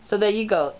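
normal talking voice

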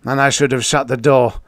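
raving. A narrator's reading. Tusman blames himself for the open door, raving. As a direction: rave it out forcefully. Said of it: "he raved".

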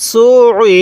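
"সু ই" with a held throat ayn between the vowels; teacher's demonstration of doubled ayn in "সু ই". Shown as a demonstration. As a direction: squeeze the Arabic ayn twice, joining "সু" to "ই". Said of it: "আইনটা কিন্তু আমি দুইবার উচ্চারণ করছি".

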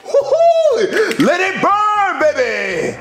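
high, drawn-out gleeful holler; wild whooping. Acted. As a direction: whoop it up over the flames. Chef whoops as food burns.

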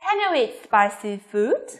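slow yes-no question lifting on "food"; rising intonation. Shown as a demonstration. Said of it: "lên giọng ở cuối câu".

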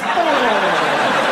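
loud far yelped oh, like a poked snail; snail recoiling. Retold or imitated. Comic recoiling snail voice after a poke. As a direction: recoil with a squeal. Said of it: "and it goes".

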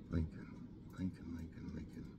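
quiet muttering while deciding; muttering to self. Real, not acted. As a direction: mumble it to yourself while thinking.

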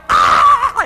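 loud, high scream of Ah; wordless yell. Retold or imitated. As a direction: let out a raw falling scream. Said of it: "You yell".

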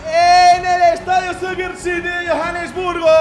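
slow, loud stadium announcement; announcer voice. Acted. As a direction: announce the final grandly. Announcing the final like a broadcast.

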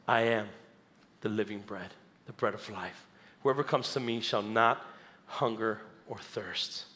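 low, quiet declaration with weighty pauses; Jesus. Acted. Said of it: "He says".

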